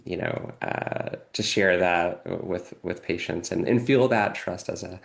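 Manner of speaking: usual speaking voice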